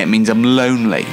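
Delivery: ordinary delivery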